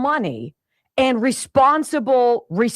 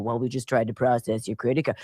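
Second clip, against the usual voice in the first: low, softer imitation of a phone caller; mock the routine billing caller, low and quiet; 9 semitones lower, 5 dB quieter than usual. Merchant phoning about the credit card, routine low voice.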